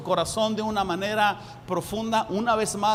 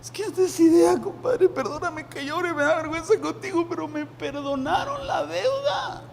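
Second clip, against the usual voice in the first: tearful; 9 semitones higher than usual. Acted. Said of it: "se quiebra ahí llorando".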